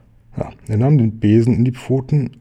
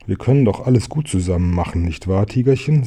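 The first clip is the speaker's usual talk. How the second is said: quick coaxing pig; faster than usual. Acted.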